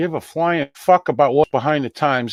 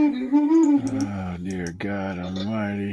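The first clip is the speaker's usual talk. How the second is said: exasperated groan; 4 semitones lower, 6 dB quieter, much slower than usual. Real, not acted. Exasperated at computer trouble.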